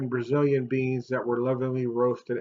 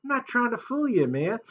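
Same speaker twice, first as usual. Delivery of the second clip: ordinary delivery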